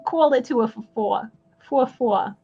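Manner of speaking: put-on light Long Island New York accent on "Call it to her for four"; a hint of Long Island, leaning on "four". Trying out the accent for the auction call, light Long Island accent. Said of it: "Long Island, New York, like that kind of accent".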